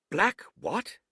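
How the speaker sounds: high sharp question; sharp surprise. Acted. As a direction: snap the question. Silver asks sharply.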